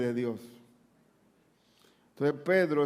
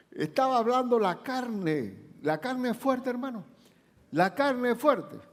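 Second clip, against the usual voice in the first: high preaching exhortation; 9 semitones higher than usual. Acted.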